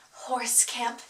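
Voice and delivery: mock-dramatic soft title reveal; hushed reveal. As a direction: drop it low and soft for the reveal.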